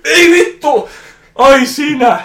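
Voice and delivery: very high, loud shocked yelp; startled shriek. Retold or imitated. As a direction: jump out of your skin mid-word. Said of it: "se säikähtää ihan vitusti". (säikähtänyt kiljaisu)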